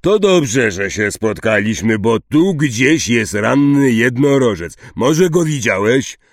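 forceful, eager questioning; hearty Hagrid. Acted. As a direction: press a friend for news.

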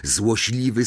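ordinary delivery, nothing marked

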